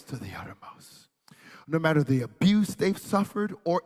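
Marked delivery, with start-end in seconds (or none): 0.0-1.1 soft near-whisper, lower; whispered assurance to the congregation; hushed refrain. Real, not acted. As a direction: let the refrain sink to a hush.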